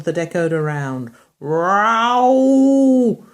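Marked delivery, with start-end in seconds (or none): 1.4-3.2 drawn-out yowling cat; a cat wailing from a branch. Acted. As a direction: yowl it like a stuck cat. Cat yowl sound in story.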